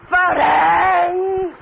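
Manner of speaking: slow, high sobbing cry of the name; anguished crying. Acted. Countess sobs and cries out to the husband who left her. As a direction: wail for him through tears. Wife wails for lost husband.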